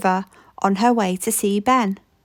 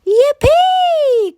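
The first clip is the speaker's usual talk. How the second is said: put-on high, loud child's whoop of joy; excited cry; 5 dB louder than usual. A narrator's reading. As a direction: shout "Yippee!" like a thrilled little boy. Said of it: "cried Ben".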